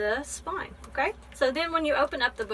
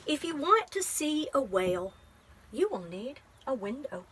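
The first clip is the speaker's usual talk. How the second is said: gentle, measured picture-book reading; teacher reading a poem book aloud to a child; reading to children. Acted. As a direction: read it like story time.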